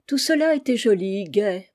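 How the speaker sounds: plain reading voice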